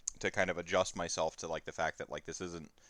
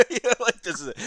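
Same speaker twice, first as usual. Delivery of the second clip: high, loud laugh breaking into words; real amused laughter while talking; 10 dB louder, much faster than usual. Real, not acted. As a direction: crack up mid-sentence. Laughing at his own story.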